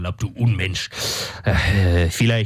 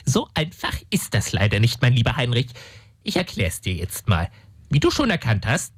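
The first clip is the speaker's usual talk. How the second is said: ordinary delivery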